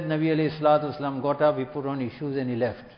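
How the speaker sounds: quick, matter-of-fact retelling; brisk plain telling. Shown as a demonstration. Preacher giving the blunt version of the hadith, quick and plain. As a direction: rattle it off like a bare report. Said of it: "She could have just said".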